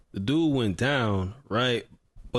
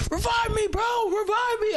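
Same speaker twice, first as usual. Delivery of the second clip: imitated yell. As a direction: shriek it like a panicked teammate. Teammate screaming in his ear.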